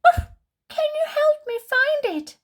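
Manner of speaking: high, eager little puppy asking for help; squeaky puppy pleading for his lost ball; puppy asks Bunny for help, high and eager. Acted. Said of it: "he asks Bunny".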